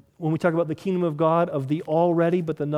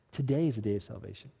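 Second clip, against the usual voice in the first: much softer and lower; hushed refrain; 8 semitones lower, 10 dB quieter, faster than usual. Acted. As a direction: say the refrain almost under your breath. Quiet repeated refrain.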